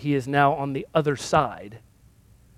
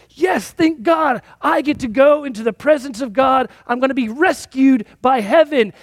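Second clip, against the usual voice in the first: mock relieved excitement; 9 semitones higher, 5 dB louder than usual. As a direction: play the lost man thrilled to be saved. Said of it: "He's like".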